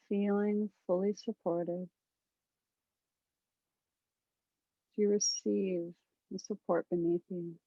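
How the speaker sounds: calm meditation guide, long pauses between phrases; guided meditation. Acted. Guide settling a group into a body scan, calm guided meditation. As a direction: lead them gently through breath and body, unhurried.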